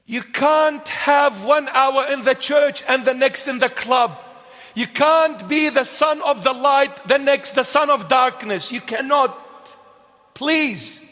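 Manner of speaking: high, loud, pleading sermon; preaching at full force. Acted. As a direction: press the crowd hard, no compromise. Preaching against half-hearted faith.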